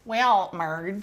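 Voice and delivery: hammed-up Minnesota accent, Marge-style; lightly voiced Minnesota accent. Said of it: "That's better".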